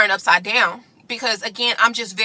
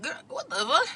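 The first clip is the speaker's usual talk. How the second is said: attitude; 6 semitones higher, 9 dB quieter than usual. Retold or imitated. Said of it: "that kind of attitude again".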